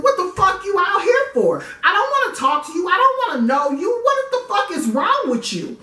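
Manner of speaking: cornered woman lashing out to mask her fear; defensive yelling. Retold or imitated. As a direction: push him away with anger. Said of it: "my thing is to get defensive".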